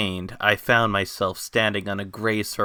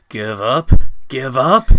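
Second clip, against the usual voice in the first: "Give up?" shouted louder each time, climbing up; outraged shout; 4 semitones higher, 12 dB louder, slower than usual. A narrator's reading. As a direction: fling "Give up?" back with rising fury. Defiant rising outburst.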